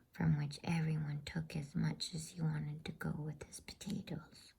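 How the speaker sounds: usual speaking voice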